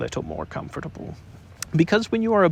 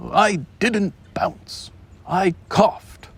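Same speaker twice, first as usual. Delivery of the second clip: slow, higher indignant denial; cross; 6 semitones higher, 4 dB louder, much slower than usual. Acted. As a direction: insist it was only a cough. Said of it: "said Tigger crossly".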